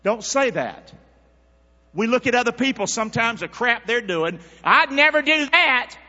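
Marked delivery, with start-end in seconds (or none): 4.6-6.0 higher, louder and slow, smug and sure of yourself; mock self-righteous. Preacher mocking judgmental churchgoers, smug, higher and louder. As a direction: play the holier-than-thou churchgoer. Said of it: "You're a nut".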